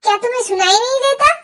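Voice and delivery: loud, fast angry retort; angry shout. Acted. [name] shouting at his mother in anger, loud and fast. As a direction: cut the aunt off hard. Mother scolded, snaps back.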